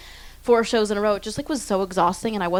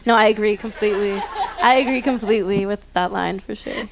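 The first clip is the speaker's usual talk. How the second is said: laughing through the words; 4 dB louder than usual. Real, not acted. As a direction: agree while still laughing. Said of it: "laughing while agreeing".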